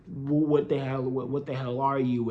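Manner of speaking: ordinary talk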